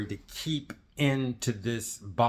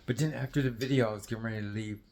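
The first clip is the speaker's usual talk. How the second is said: ordinary delivery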